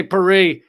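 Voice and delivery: "Paris" said the French way, pa-REE; Paris as the French say it, no final s. Shown as a demonstration. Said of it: "I sound like an asshole".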